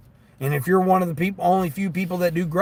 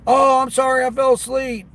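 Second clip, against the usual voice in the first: high, louder mock excuse; mocking customer; 8 semitones higher, 3 dB louder than usual. Driver repeating the no-tip customer's excuse in a mocking voice. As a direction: mimic the sleepy apology again.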